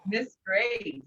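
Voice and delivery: ordinary delivery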